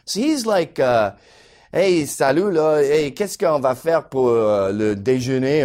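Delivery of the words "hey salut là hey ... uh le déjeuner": French Canadian speaking French. Retold or imitated. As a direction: imitate a French-speaking buddy chatting to a waiter. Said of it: "Why not speak French?".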